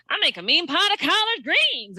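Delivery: high, exaggerated Madea-style accent; mock folksy Madea accent; put-on Madea-like accent. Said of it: "cadence appears from her that she, like, she's Madea".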